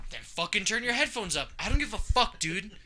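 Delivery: high-pitched mock-angry retort; retold mad comeback. Telling off the roommate while watching TV, mock mad. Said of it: "I got fucking mad".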